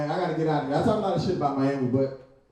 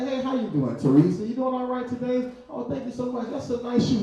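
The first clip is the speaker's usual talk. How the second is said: higher, sweet and proper, polite greetings; a bright well-mannered hello to a coworker; 7 semitones higher than usual. Retold or imitated. Comic polite self greeting a coworker, mild higher tone. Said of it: "As soon as somebody bring out a camera".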